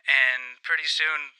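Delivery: ordinary delivery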